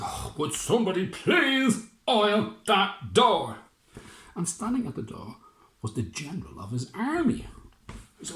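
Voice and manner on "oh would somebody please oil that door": high, loud, slow exasperated mayor; fussy mayor. Acted. Mayor fed up with the creaky door, slow and high. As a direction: drag out the complaint.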